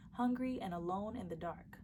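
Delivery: ordinary delivery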